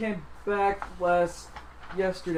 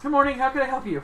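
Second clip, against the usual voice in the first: high cheery clerk voice; 8 semitones higher, 5 dB louder, faster than usual. Acted. GM voicing clerk greeting.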